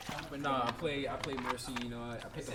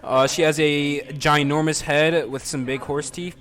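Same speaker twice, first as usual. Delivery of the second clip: loud teasing; 14 dB louder, slower than usual. Real, not acted. Teasing his girlfriend on stream.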